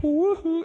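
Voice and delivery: loud, high woo-hoo; playful loud whooping noise; imitated whoosh whoop. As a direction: swoop it out like an idea flying in. Imitates the Lord delivering idea.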